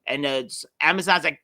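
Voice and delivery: normal talking voice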